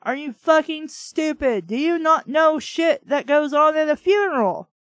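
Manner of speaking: ordinary delivery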